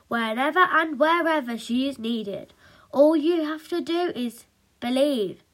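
lightly grand, heroic declaration; a touch of mock-inspirational hero; superhero promise. A narrator's reading. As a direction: proclaim it for all to believe.

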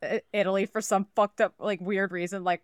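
normal talking voice

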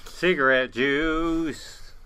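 slow, loud, savoring each word; slow and drawn-out. Pretend. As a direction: drag it out for the joke. Savoring joke phrase.

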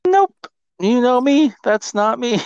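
very high, loud protest; mock denial. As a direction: squeal a joking denial. Joking denial of wanting solitude.

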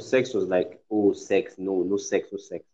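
usual speaking voice

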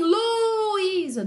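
"Luísa" sung out high with a long first part; wrongly stretched call. Shown as a demonstration. Teacher showing how the mother won't call, "Luísa" stretched early. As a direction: stretch the start of "Luísa" high, dropping at the end. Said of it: "Ela não vai falar assim".

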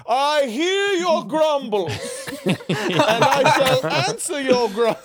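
high, slow, grandiose mock-God proclamation; booming God impression. God answers the grumbling people, mock-grand and slow. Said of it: "God at one point is like".

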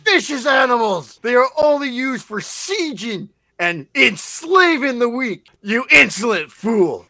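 indignant rant. Acted. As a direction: defend the ferrets hotly. Minion rants about ferrets.

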